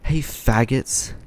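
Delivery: imitated yell. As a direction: call it out slowly like a taunt across a street. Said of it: "and yells".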